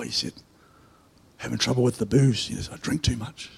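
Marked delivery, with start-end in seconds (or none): 1.4-3.5 confessing quietly. Retold or imitated. Worker confessing his drinking to the pastor, low and hushed. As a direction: lower and much softer.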